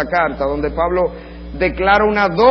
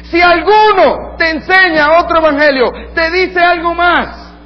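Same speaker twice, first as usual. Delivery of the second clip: loud, high, slow shouted curse; furious curse; 11 semitones higher, 7 dB louder, slower than usual. Retold or imitated. Paul cursing false teachers, shouted loud and high. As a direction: hurl the word maldito at them.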